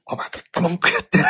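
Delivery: angry shout. Retold or imitated. Father scolding his complaining son, loud yell. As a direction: bark it at him again. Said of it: "もうものすごい声で".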